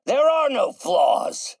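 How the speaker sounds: high, loud, drawn-out shout; furious wounded-pride outburst; enraged denial. Acted. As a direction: thunder it, stretching the words. Genius enraged by criticism.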